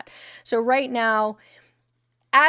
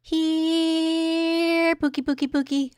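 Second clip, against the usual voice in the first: high sing-song calling as to a pet; coaxing pet call; 6 semitones higher, much slower than usual. Real, not acted. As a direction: coax Pookie over like a puppy.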